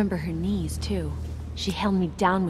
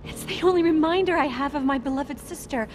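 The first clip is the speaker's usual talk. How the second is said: emotional relief; 7 semitones higher than usual. Acted. Lost keepsake returned, acted emotion.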